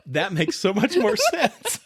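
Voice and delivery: laughing while talking. Real, not acted. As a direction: talk through the laughter. Laughing after joke.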